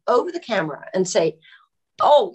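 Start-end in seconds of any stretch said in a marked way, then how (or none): 2.0-2.3 put-on higher, louder stab at a Brooklyn accent; attempted Brooklyn accent. Director calling over the camera, rough Brooklyn accent. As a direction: imitate a Brooklyn director calling out, badly faked. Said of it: "his Brooklyn accent, which I cannot do".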